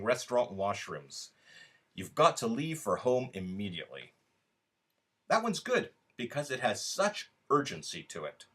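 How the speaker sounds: expressive literary reading, speaking to you; story reading. A narrator's reading. Author reading his short story to online voters, expressive reading. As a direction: read it as a wry, intimate monologue.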